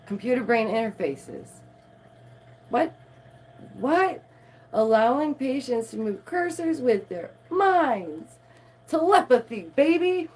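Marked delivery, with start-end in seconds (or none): 2.7-4.2 shocked what. Real, not acted. Reacting to computer brain interfaces, shocked. 8.9-10.3 much higher and louder, hyped; hype telepathy. Real, not acted. Hyping mind control to viewers, loud excited.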